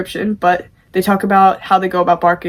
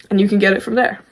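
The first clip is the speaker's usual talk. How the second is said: ordinary delivery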